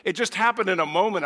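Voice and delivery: normal talking voice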